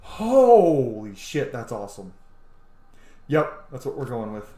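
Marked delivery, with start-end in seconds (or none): 0.0-1.5 loud, slowly stretched holy shit; amazed exclamation. Real, not acted. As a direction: let the awe spill out. Seeing a cool armour look.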